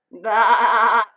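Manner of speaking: a loud sheep bleat. Acted. Sheep answers Duck.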